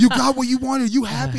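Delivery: loud, high exasperated caving-in yell; retold yelling. Car salesman yelling at the buddy as he gives in: loud, high yell. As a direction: yell it like a beaten salesman giving up. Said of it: "the salesman's yelling at my buddy".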